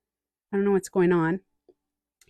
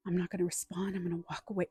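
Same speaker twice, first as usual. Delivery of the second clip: softer, quiet self-talk; hushed inner resolve; 10 dB quieter, faster than usual. Pretend. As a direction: say it under your breath to yourself. Inner voice of stiff control.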